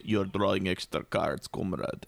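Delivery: put-on low, slow Russian accent. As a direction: talk like a stern Russian enforcer. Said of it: "the Russians are gonna be like".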